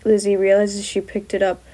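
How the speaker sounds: usual speaking voice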